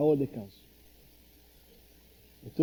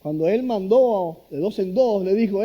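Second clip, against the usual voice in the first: usual speaking voice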